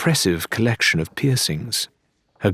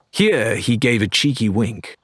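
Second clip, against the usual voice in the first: plain reading voice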